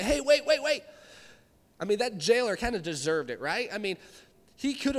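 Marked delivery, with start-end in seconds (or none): 0.0-0.8 calling out. Pretend. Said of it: "stop and say".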